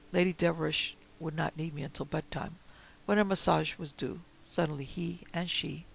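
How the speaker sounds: ordinary delivery, nothing marked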